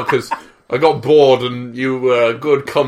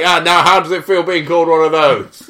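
loud, high mocking jeer; loud mock jeer at cowards; heckling taunt; 5 semitones higher, 5 dB louder than usual. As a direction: rub it in like a heckler. Taunting Death Eaters as heckler.

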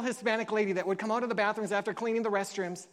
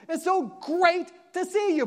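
higher, slower, delighted welcome; joyful greeting; 7 semitones higher than usual. Retold or imitated. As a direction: greet him like an old friend. Pastor greets Bo warmly.

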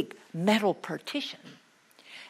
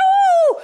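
very high, loud squeal; high excited hoot; 14 dB louder than usual. Acted. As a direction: squeal like a troll. Imitating excited trolls.

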